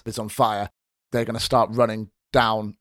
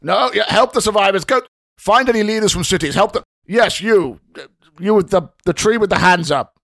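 loud, higher commanding bark; barking orders; 7 semitones higher, 6 dB louder than usual. Acted. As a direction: bark it like a drill officer. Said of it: "He starts barking orders from it".

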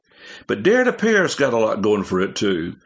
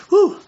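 a loud, high whew of relief after the list; breathless whew; 5 dB louder than usual. Real, not acted. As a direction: puff out a big whew. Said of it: "I'm out of breath with that".

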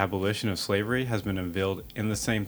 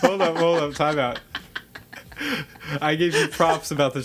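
louder, higher mock protest; 8 semitones higher, 7 dB louder than usual. Real, not acted. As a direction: stop him loudly, half joking.

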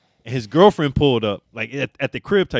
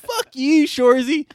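imitating teammates; 4 dB louder, slower than usual. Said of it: "They're like".

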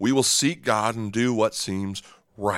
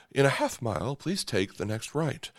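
much softer, politely unhurried navigation voice; polite British GPS voice; 7 dB quieter than usual. Retold or imitated. Pastor imitating his polite British GPS, softly polite. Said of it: "the female British voice comes across a little more polite".

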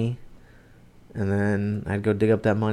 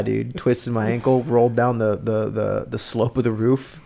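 ordinary delivery

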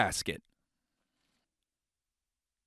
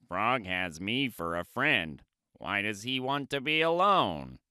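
lower, puzzled Toad; Toad's voice; 5 semitones lower, 3 dB quieter than usual. Acted. Toad reading the note, puzzled and low. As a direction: wonder aloud as a hurt Toad.

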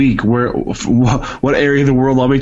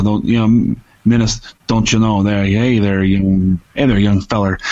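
lower-pitched Minnesotan folksy voice; rehearsed Minnesota accent, dropped lower; 5 semitones lower than usual. Shown as a demonstration. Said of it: "So, equal parts Ca-, Canadian and Minnesota".

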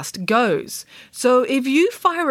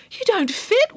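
put-on worried girl; 13 semitones higher than usual. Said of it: "worried Samantha".

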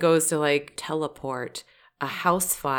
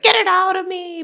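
frantic scream; 5 dB louder than usual. Retold or imitated. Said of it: "she was like".

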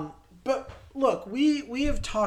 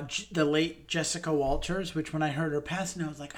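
ordinary talk, nothing marked

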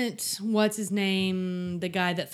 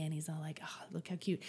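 whisper; 3 semitones lower, 12 dB quieter than usual. Retold or imitated. Whispered gossip retelling.

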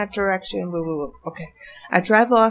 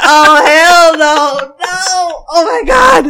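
long, high, loud wail; hammed-up shriek, drawn out and climbing; alarmed outcry; 7 semitones higher, 11 dB louder, much slower than usual. Seeing the thief run into the parking lot, alarmed outcry. Acting her alarm at the chase.

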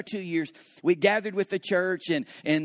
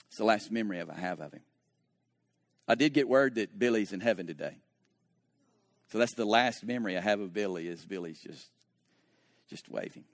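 tender grief; 7 semitones lower, 3 dB quieter than usual. Real, not acted. Sad memory of dead friend.

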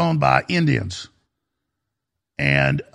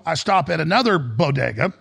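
ordinary delivery

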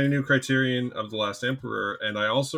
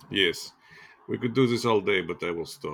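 put-on terrible Russian accent. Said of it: "my terrible Russian accent".

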